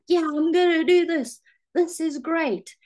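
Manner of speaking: imitating her own hyped-up start; mock gung-ho resolve; eager excitement. Mock eager self, new habit.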